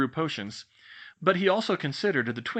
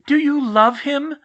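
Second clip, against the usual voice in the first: slow, loud, high blurted question; blurting the question out; 12 dB louder, slower than usual. Acted. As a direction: blurt it out unthinking. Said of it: "Hermione blurted it out without thinking".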